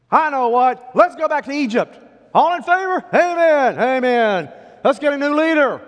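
high, loud rallying calls; rabble-rousing. Acted. Rebel urging Israelites back to Egypt, loud rallying. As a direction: whip up the crowd like a mutineer. Acting out the people's revolt.

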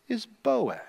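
softened reveal after a pause; dramatic pause before Boaz. Shown as a demonstration. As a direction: hold a beat, then drop to a soft "is Boaz". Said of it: "pause for emphasis".

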